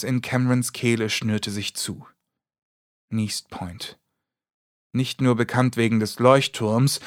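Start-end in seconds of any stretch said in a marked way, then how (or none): 3.1-4.0 1 hushed dreading echo. A narrator's reading. Narrator echoes with dread.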